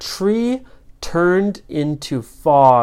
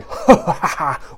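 mocking evil laugh; theatrical sorcerer's ha-ha-ha; villain laughing; slower than usual. A narrator's reading. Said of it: "Morgoth laughed and he said".

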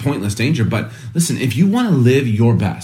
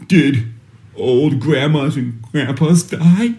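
put-on a small boy's voice, higher and very slow; son near tears; 4 semitones higher, much slower than usual. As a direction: lightly voice the son with a quivering lip. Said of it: "He's like".

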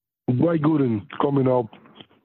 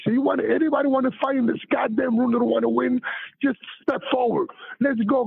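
high-pitched retold outraged rant; furious rant; 9 semitones higher than usual. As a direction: protest the fine and dare anyone to fight, pitched high. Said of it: "I said".

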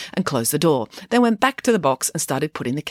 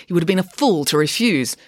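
plain reading voice

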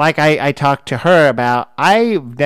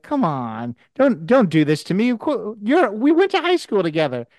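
imitated insistent plea, pitched higher; mock pleading; 7 semitones higher than usual. Host imitating the prank caller insisting they know each other, mock pleading. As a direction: lightly act out a coaxing, disbelieving protest.

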